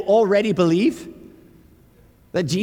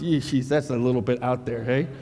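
put-on doubter, much lower than the gushing; mock scepticism; 5 semitones lower than usual. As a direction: drop low into dubious disapproval. Voicing doubters, after amazed take.

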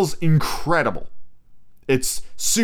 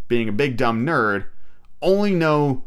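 ordinary talk

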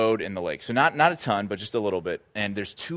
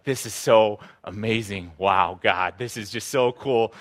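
awed; slower than usual. Retold or imitated. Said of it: "like, shocked".

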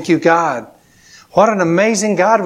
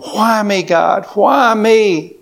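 raised, higher-pitched wail of complaint; mock anguished cry; 5 semitones higher, much slower than usual. Man whose cabin burnt down cries to God, mock anguish. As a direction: cry out in hammed-up despair.

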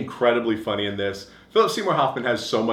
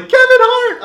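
high, loud re-enacted fan squeal; excited shout; 10 dB louder, slower than usual. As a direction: cry out as if thrilled by a surprise sighting. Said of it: "I was so excited".

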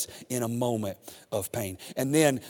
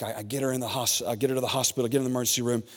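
usual speaking voice

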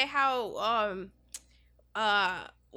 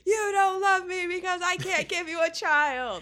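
high, loud hammed-up accusing yell; mock drunken wife shout; 6 semitones higher, 6 dB louder than usual. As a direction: hurl it like a fight over glasses. Acted marital quarrel, glasses thrown.

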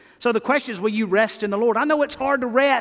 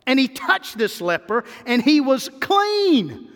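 normal talking voice

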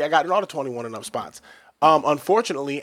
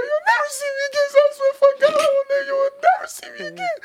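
very high, frantic squeal; panicked promise; 4 dB louder than usual. Retold or imitated. Scared young man swearing to the father he'll never return. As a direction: swear it in total terror. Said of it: "I was like".

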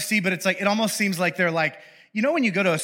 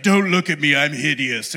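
louder, hammed-up despair; mock ashamed angel; 3 semitones lower, 4 dB louder than usual. Angel hiding under his cloak, hammed-up shame. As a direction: hide your face in drama. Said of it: "he's like".

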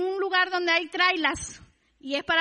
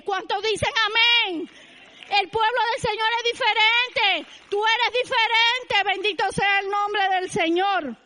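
rousing preaching; 4 semitones higher, 5 dB louder than usual. Real, not acted.

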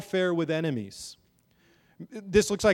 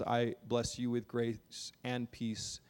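soft, slow blessing over the people; tender benediction; 5 semitones lower, 9 dB quieter, slower than usual. Acted. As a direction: breathe the blessing gently onto them. Closing benediction blessing the congregation.

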